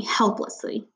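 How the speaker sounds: usual speaking voice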